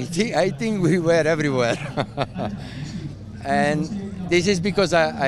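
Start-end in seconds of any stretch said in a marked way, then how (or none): 1.8-3.4 real amused chuckle; short, easy run of chuckles; laugh. Real, not acted. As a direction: laugh softly at your own remark. Joking he has raced everywhere.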